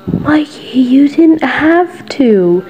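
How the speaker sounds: high, slow breathless whisper; whisper, moved near tears; touched whisper. Acted. Listener moved by Mikey's portrait gift, whispered.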